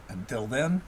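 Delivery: ordinary delivery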